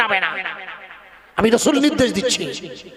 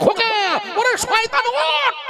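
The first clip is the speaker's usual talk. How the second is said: high, slow wailing call to his drowning son; father calling son; 13 semitones higher, slower than usual. Acted. Noah calling to his drowning son, high slow wail. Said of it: "তাই ছেলেকে বলে".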